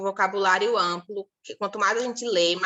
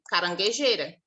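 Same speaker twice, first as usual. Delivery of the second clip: ordinary talk, nothing marked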